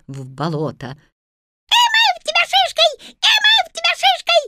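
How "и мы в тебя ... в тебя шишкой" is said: very high, fast squealing yell; shrieking swamp sprites. A narrator's reading. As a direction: shriek it back gleefully. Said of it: "орут кикиморы". (визгливые кикиморы кричат)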